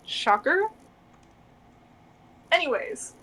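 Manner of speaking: ordinary talk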